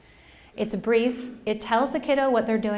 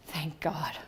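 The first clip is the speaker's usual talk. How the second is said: mock relieved whisper; 4 semitones lower, 8 dB quieter than usual. Relieved teacher, whispered aside.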